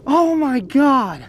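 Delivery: slow, high, loud amazement; awed disbelief. Real, not acted. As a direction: draw it out in awe. Stunned by big fish.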